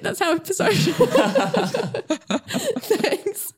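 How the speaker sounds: a laughing, giddy sign-off. Real, not acted. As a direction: giggle out the goodbye.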